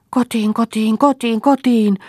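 high, drawn-out wailing shout; child's cry. A narrator's reading. Small boy clinging to Liisa, crying out to go home. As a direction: wail it out loudly like a frightened child. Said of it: "huusi Yrjö".

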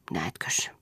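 breathy unvoiced whisper. A narrator's reading. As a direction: whisper it like a secret. Whispered aside in story.

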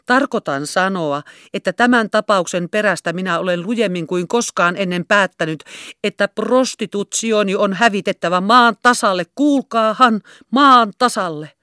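shouting hoarse and rough, climbing to maan tasalle; coarse loud shout. Acted. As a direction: bellow it to drown out the tears. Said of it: "huusi hän yhä samalla äänellä".

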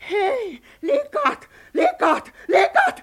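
stammering, overjoyed shout of winning; excited shouting. Acted. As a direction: shout the news to the sisters. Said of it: "elä nyt huuda".

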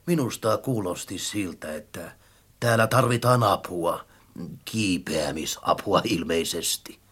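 deep, soft creature voice; friendly ladder. A narrator's reading. Ladder offers climbing help to children, kindly. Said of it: "tikkaat sanoivat ystävällisesti".

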